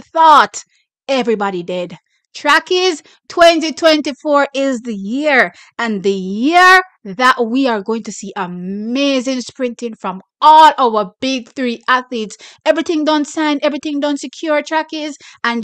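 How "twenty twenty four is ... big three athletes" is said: usual speaking voice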